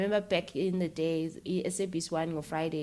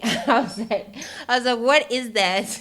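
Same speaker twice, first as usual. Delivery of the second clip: laughing disbelief; 4 semitones higher, 7 dB louder than usual. Real, not acted. Laughing recalling confusion.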